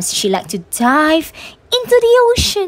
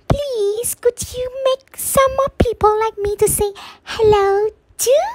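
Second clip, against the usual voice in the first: put-on high, small childlike voice, asking sweetly; a tiny clay person begging for friends, much higher; little person asking Nuwa to make more people; little person pleading; 9 semitones higher than usual.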